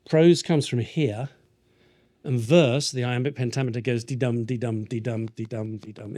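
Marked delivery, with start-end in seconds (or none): 4.0-6.2 DUM stressed in each dee-dum, steady beat fading away; even, pulsing dee-DUM, softening towards the end; heartbeat rhythm. Shown as a demonstration. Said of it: "It's a heartbeat".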